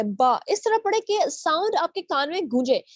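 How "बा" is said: बा low and resounding, mouth open; stressed B. Shown as a demonstration. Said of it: "mouth थोड़ा सा open करके". (खुले मुँह से ज़ोर देकर "बा")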